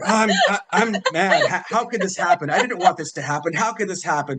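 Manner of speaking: a touch of exasperated outrage; retold anger. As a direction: replay your own upset rant, lightly hammed. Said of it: "I was so pissed at that point".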